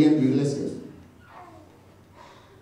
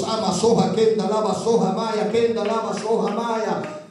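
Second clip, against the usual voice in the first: speaking in tongues; 5 semitones higher than usual. Real, not acted.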